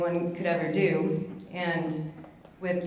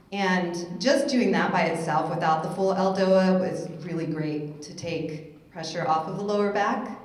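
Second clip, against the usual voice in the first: ordinary talk, nothing marked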